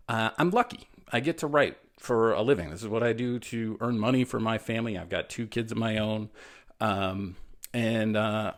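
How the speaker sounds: ordinary delivery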